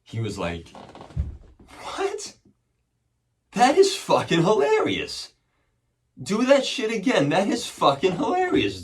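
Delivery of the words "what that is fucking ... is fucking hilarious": high, loud imitation of a thrilled officer; amazed, delighted cop. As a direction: whoop it as a suddenly won-over cop. Cop delighted by trick in retold story.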